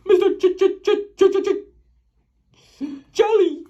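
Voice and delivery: stuttering on Ch over and over; stammering. Acted. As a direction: stammer in nervous fright. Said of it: "he stammered".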